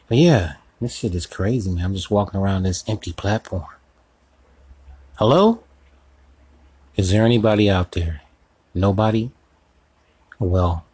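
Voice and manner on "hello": high, loud hello into the void; calling out. Acted. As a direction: call out to anyone there. Calling into the empty platform.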